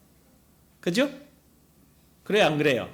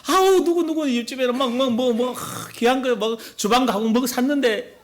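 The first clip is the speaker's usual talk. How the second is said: starts much higher, then rambling and wistful; envious wife hinting; 5 semitones higher than usual. Retold or imitated. Wife dropping hints to her husband about the neighbour's kitchen, high and gushing. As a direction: gush about the neighbour's new kitchen to drop a hint.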